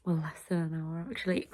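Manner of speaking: late night whisper. Real, not acted. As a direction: whisper so nobody wakes. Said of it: "It's two thirty-eight A M".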